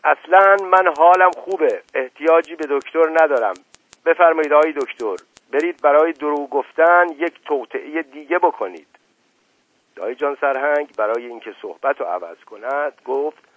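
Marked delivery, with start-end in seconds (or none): 0.0-8.9 much louder and higher, furious. A narrator's reading. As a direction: drive the doctor out at full voice. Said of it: "با صدای بلندتری گفت". (با صدای بلندتر و خشمگین)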